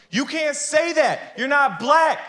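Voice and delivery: indignant woman. Retold or imitated. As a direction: scold him like the offended woman, high. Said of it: "This Pakistani woman gets up and goes".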